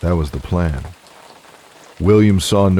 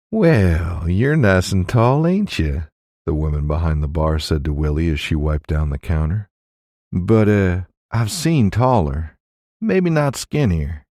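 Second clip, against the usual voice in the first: teasing innkeeper woman. Acted. Innkeeper woman teases William.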